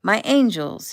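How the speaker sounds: loud, high delighted greeting; light up as you greet the girls; swooping, joyful exclamation. A narrator's reading. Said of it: "his face lit up".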